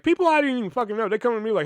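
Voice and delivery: normal talking voice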